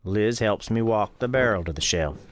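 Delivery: plain reading voice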